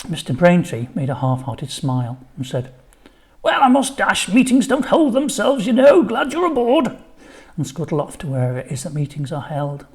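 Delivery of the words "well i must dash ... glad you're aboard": high, loud, fussy headmaster; bustling busy head teacher, up high. A narrator's reading. Headteacher rushing off.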